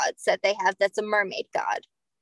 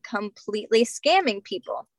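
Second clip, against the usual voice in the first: ordinary talk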